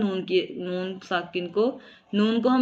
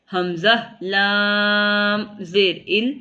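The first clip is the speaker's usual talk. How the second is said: slow spelling out; much slower than usual. Shown as a demonstration. Teacher spelling the word slowly for students. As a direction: spell लाम अलिफ जबर ला letter by letter, slowly. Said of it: "अगर हम हिज्जे करें".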